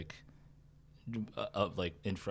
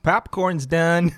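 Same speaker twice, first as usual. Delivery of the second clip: loud, slow announcement; calling out; 6 semitones higher, 11 dB louder, much slower than usual. Acted. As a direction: call it out across the lobby. Concession worker calling out.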